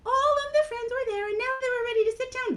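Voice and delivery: high, loud puppet storyteller; Mimi. Acted. Mimi continues the party story, high puppet voice. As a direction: carry the tale on as a tiny character.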